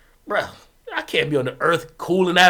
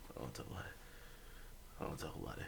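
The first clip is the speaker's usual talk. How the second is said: softer, breathy embarrassed mumble; embarrassed whisper; 30 dB quieter, much faster than usual. Real, not acted. Refusing to tell the embarrassing story, whispered. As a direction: barely voice it, too embarrassed.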